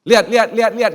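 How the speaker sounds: high, loud look-at-me boasting; loud smug demand; arrogant showing off. Pretend. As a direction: call out proudly to the crowd. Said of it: "sombongnya saya kali gitu".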